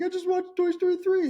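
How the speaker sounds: high, tearful mom voice; mom crying. Retold or imitated. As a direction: squeak it out between sobs. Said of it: "And she was like".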